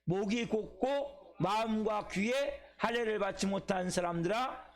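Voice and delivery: slow, measured reading of scripture. Shown as a demonstration. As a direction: read each phrase slowly and deliberately. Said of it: "같이 봅시다. 시작".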